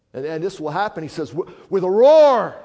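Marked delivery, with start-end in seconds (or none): 1.3-2.6 very loud, high, drawn-out roar; roaring proclamation. Real, not acted. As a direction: thunder it out, stretching the last word. Declaims the verse's roar at full force.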